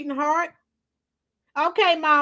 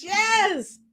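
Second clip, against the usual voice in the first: usual speaking voice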